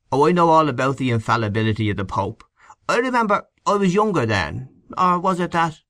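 lightly voiced Irishman recalling; eager reminiscer. A narrator's reading. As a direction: lift the pitch as memory comes back.

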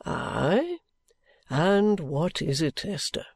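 unhurried, curious guardian; kindly guardian, inquiring. Acted. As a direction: ask as the guardian, low and unhurried. Voices the guardian.

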